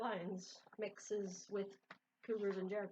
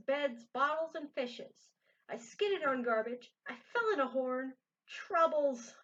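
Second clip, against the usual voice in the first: lively read-aloud voice for a picture book; story narration; 5 semitones higher, 6 dB louder than usual. A narrator's reading. Reader to viewers, reading a Seuss book aloud. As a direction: tell the rhyme with bounce.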